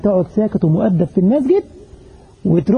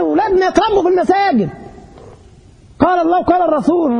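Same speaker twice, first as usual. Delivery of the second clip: higher pleading preacher's cry; 8 semitones higher than usual. Shown as a demonstration. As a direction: raise the pitch and plead, pressing يتربوا. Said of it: "نقول: يا جماعة".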